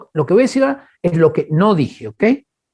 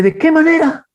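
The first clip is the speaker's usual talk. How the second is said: startled question; 11 semitones higher, 3 dB louder than usual. Retold or imitated. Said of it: "Yo dije".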